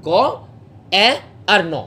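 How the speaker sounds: ক, এ, ন spaced apart; sounds one by one. Shown as a demonstration. Teacher splitting a word into its sounds. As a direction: say each sound of ক্যান separately. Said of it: "speech sound কী কী আছে?".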